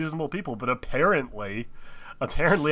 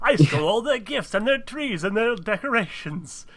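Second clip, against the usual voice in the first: Grinch voice; 8 semitones higher than usual. Pretend. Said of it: "Pondered the Grinch".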